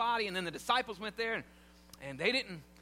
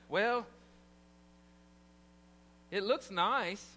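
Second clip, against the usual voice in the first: grudging well; slower than usual. Retold or imitated. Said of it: "Ladies, you too".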